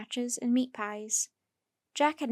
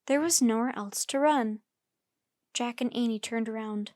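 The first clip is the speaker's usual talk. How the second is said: usual speaking voice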